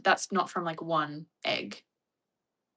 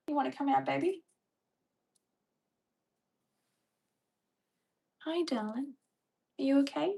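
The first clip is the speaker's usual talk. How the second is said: higher-pitched cooing to a pet; tender pet talk; 7 semitones higher than usual. Real, not acted. Cooing to her pet bird.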